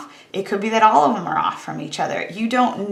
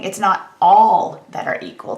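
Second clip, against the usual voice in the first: "all" punched louder than the rest; stress on "all"; 5 dB louder than usual. Real, not acted. Stats teacher clarifying the complement for students, stress on "all". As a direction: hit "all" firmly mid-phrase. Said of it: "I wanna emphasize the word all".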